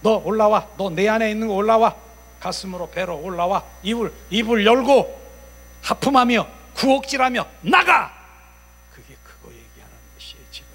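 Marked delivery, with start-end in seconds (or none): 0.0-8.1 loud, high-pitched orders; commanding expulsion. Acted. Preacher ordering spirits up and out, commanding expulsion. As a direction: build to a shouted dismissal.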